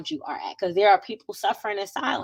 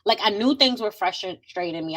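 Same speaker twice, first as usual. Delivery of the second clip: ordinary delivery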